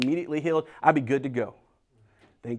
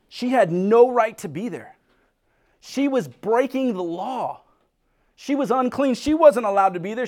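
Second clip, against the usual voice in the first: louder, urgent sermon building on each line; full-force preaching; 3 semitones higher, 4 dB louder than usual. Acted. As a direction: drive the point home to the congregation.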